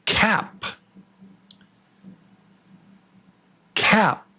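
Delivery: "cap" said high with a flat front "a"; quiz word demo. Shown as a demonstration. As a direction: lift the pitch and say "cap" clearly. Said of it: "The word is".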